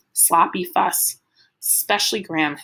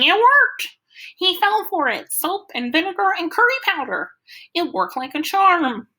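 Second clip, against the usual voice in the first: much higher, loud triumphant crowing; manic glee; 11 semitones higher, 3 dB louder than usual. A narrator's reading. Freak crows to the cops that his trick worked, manic glee. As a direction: gloat like a giddy mad scientist. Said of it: "Freak is laughing like a maniac and saying".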